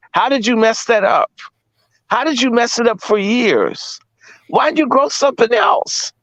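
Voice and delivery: high, laughing disbelief at their blunder; incredulous laugh. Real, not acted. Mocking the potato farmers in laughing disbelief.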